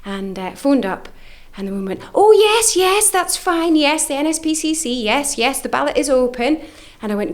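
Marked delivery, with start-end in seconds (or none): 2.1-6.6 much higher, bright and bubbly; chirpy phone lady. Retold or imitated. Charity woman on the phone confirming the ballot, chirpy and high. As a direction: play the overly cheerful call-centre woman.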